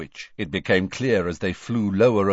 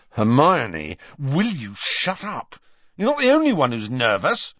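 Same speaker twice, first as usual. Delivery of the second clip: loud irritated bark; 6 semitones higher, 5 dB louder than usual. Acted. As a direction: snap at her to stop. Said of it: "barked Ron".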